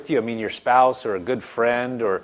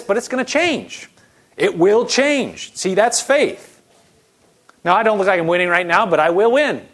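declaring faith; 4 semitones higher than usual. Acted. Pastor modelling a faith confession, declaring preaching. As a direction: proclaim it like a promise.

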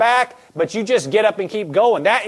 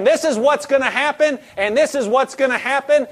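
higher-pitched acted confident declaration; imitated fighting confidence, pitched up; bold declaration; 6 semitones higher than usual. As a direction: call it out like David charging Goliath. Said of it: "He was running saying".

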